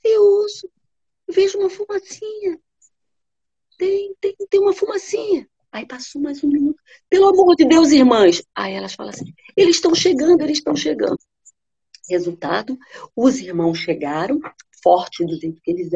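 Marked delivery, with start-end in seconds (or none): 0.0-5.5 much higher sister's voice; a high, eager report from afar; sister in the tower. Acted. The sister on the tower answering the wife in a much higher voice. 7.1-8.4 much higher, louder cry to the sisters; desperate plea. Acted. The wife desperately begging her sisters, much higher and louder. 9.5-11.2 much higher, eager announcement; excited sisters. Acted. The sisters shouting to the wife that help is coming, much higher.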